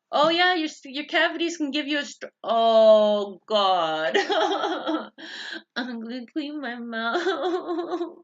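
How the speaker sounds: loud, high cry breaking into laughter; alarmed outburst. Real, not acted. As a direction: wail it out in sudden alarm, laughing. Sudden outburst about own cavities.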